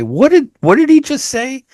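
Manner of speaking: high-pitched, bewildered retold question; baffled questioning. TV viewer lost by British dialogue, baffled. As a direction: act out a puzzled viewer, pitch jumping up. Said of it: "look it up and say".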